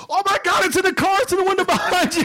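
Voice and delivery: high-pitched, breakneck mock panic; panicked imitation. Wife warning son about wasp, mock panic. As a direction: cry out a warning in comic terror.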